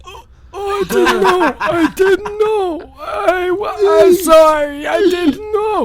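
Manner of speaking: put-on very high, wailing old-man apology; old security guard. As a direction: cry out a hammed-up, drawn-out apology. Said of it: "the Walmart security guy's like".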